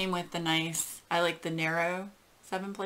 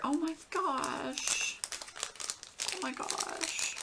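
high, slow, choked-up gasp; overcome, drawing the words out softly; emotional; 8 semitones higher, 4 dB quieter, slower than usual. Real, not acted. Dog owner moved by a paw print gift, emotional. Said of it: "I still get a little emotional".

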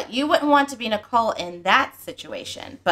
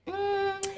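Gianna's hum; 10 semitones higher, 9 dB quieter than usual. Retold or imitated. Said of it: "You, G, would probably be like".

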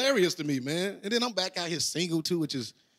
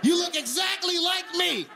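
mock outraged yell; 12 semitones higher, slower than usual. Comic shouting at the date who looks like him. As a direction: yell it like you're insulted.

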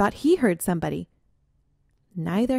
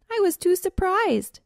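much higher, flustered skunk; high squeaky excuse; 12 semitones higher, 4 dB louder than usual. Acted.

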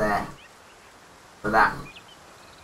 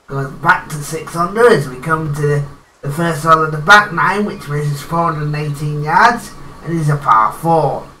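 golf commentator; 4 dB louder than usual. Acted. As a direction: call the shot like a TV golf announcer. Player narrates his golf game.

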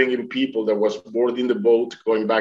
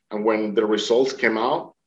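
ordinary delivery

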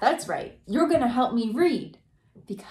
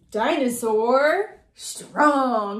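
very slow and a bit louder, flexing the word; strong; 4 dB louder, much slower than usual. Acted. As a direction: stretch "strong" out mightily. Reading strong.

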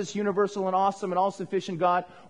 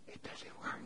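whisper; 21 dB quieter than usual. Acted. Car won't start, acted scene.

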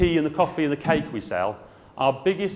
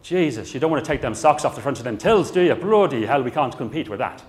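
imitated exasperated manufacturer with an Irish accent; Edward, Irish accent; 4 dB louder than usual. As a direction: protest in a put-on Irish accent. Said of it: "who said in his Irish accent".

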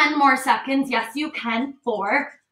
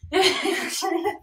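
short breathy laugh; 4 semitones higher, 4 dB quieter than usual. Real, not acted.

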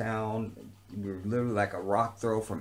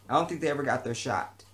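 normal talking voice

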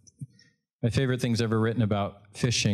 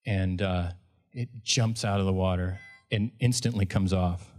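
ordinary delivery

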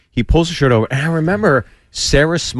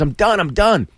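high, urgent retold plea; mock surrender; 8 semitones higher than usual. Comic plays the beaten man giving in, high and pleading. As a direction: give up quickly, voice pitched up.

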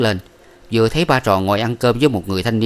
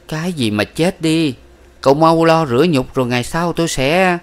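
higher, slowing, brushing him off; dismissive; 8 semitones higher than usual. A narrator's reading. Miss Ba brushes off his despair, higher. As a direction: wave his despair away.

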